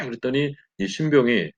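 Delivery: ordinary talk, nothing marked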